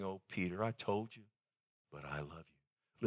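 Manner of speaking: soft, low, loving reassurance; tender Jesus. Acted. Jesus forgiving Peter with love, soft and tender. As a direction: say it like forgiving a friend.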